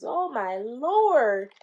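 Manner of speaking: ordinary talk, nothing marked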